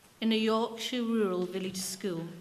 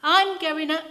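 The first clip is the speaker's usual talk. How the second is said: put-on high, very loud child's yell, cut off; a child shouting and cut off mid-wish; 9 semitones higher, 14 dB louder than usual. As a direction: shout over everyone and get stopped. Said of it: "children then to shout out".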